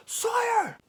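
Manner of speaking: high, loud yelled name; yelling a name. Retold or imitated. Band member spots the fan in line, loud happy yell. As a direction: call out the name like spotting an old friend. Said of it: "and he yells".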